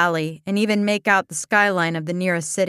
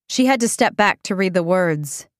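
ordinary delivery

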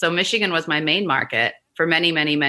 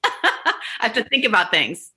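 laughing; 4 semitones higher, slower than usual. Real, not acted. Laughing at her own nerves.

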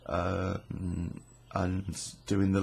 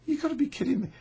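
much higher retold incredulity; amazed disbelief; much faster than usual. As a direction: pitch it up, can't believe it. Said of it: "And I was like".

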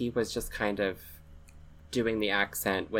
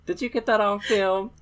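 much higher and much louder, laughing through it; gleeful movie quote; 10 dB louder than usual. Acted. Podcaster quoting the movie to her cohost, giddy and laughing. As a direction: crow it like a giddy reporter who got the shot.

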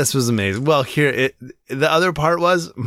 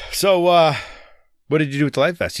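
ordinary talk

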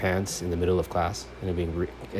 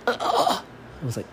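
ordinary talk, nothing marked

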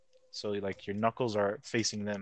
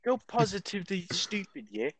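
higher mock-posh Brit insult; mock posh British; 8 semitones higher than usual. Mocking British insults, mock posh British accent. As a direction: sneer it like a toff.

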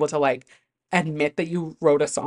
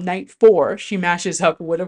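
ordinary talk

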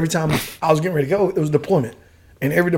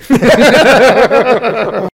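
hearty laugh; 6 semitones higher, 6 dB louder than usual. Real, not acted. Laughing at his buddy's fall.

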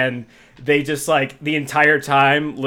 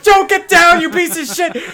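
much higher, louder yell, then dropping; imitating the host yelling at Little Joe; shouted order; 5 dB louder than usual. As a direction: bark it up at someone on a roof. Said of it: "He's like".

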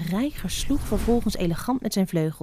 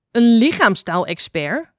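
louder, slower astonished cry; a louder, drawn-out incredulous call; 3 semitones higher, 5 dB louder than usual. A narrator's reading. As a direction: call it out in disbelief. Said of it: "riep moeder zwijn".

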